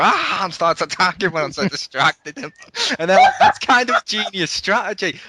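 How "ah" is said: mock battle yell. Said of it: "That's when I started to go".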